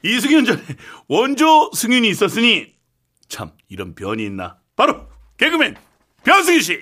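building, booming showbiz introduction; grand announcer intro. Acted. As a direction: announce him like a star. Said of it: "거창하게 한번 해볼까요".

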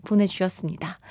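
ordinary delivery

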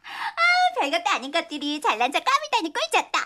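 high mocking laugh, fast; acted laughter. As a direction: laugh it off scornfully. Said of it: "그 다음은 웃는 연기야".

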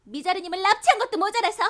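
furious, near tears; angry shout. Acted. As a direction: yell at him, voice cracking. Heroine scolds the dark lord.

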